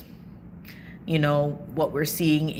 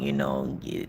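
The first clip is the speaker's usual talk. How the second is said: ordinary talk, nothing marked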